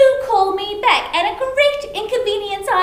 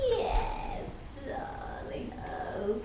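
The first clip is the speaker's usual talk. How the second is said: breathy hushed whisper to someone drifting off; barely voiced, lulling him to sleep; whispered darling, almost no sound; 11 semitones lower, 15 dB quieter, much slower than usual. Ghost lulls husband to sleep.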